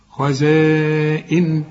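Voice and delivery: خزائن very slow, long-held "aa" before the hamza; obligatory madd. Shown as a demonstration. Teacher demonstrates the required madd, long held vowel. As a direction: stretch the alif of خزائن on a level note. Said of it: "المد ده واجب".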